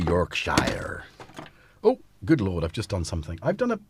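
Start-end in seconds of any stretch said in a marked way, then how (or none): 0.1-1.0 put-on Yorkshire said in an American accent, york-shy-er, lower; mock American accent. Joking to viewers how Americans say Yorkshire, American accent. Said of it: "Or as the Americans would say".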